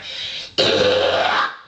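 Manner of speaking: rasping unvoiced high scream; high scream demo. Shown as a demonstration. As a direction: let out a thin rasping high shriek. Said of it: "but I'll give you my high".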